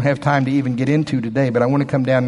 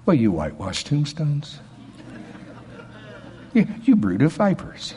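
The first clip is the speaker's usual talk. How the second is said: soft, weak, limp scolding; a gentle, toothless rebuke; mild, timid rebuke; 6 dB quieter than usual. Shown as a demonstration. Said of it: "Do you think he said it like this?".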